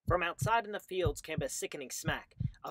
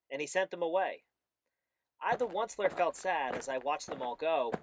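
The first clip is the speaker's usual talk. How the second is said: usual speaking voice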